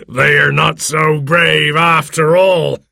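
high, slow gloating pirate voice; laughing taunt. A narrator's reading. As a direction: crow after the runaways. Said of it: "laughed Silver".

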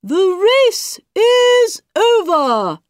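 loud, slow announcing bird; called out. A narrator's reading. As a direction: call it out grandly. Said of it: "the dodo called out".

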